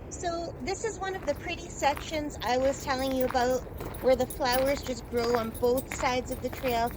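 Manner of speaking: hushed and soft; quiet voice. Real, not acted. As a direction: speak softly, barely above walking sounds. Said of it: "I'm just gonna be quiet for this section".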